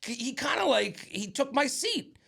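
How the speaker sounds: put-on guy fuming that a drunk took his seat; annoyed rant. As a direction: whine about your stolen seat. Annoyed rant about seat theft.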